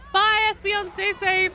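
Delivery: high, loud sing-song farewell; cheery goodbye. Real, not acted. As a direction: call out a bright goodbye. Loud farewell to a chat viewer.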